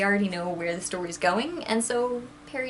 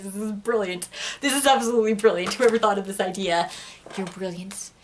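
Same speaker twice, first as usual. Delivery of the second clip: laughing. Real, not acted. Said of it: "Sorry, I can't keep a straight face".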